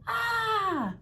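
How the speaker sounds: long high "ah" of fright; storybook scream of surprise; startled yell. A narrator's reading. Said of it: "turned around and yelled".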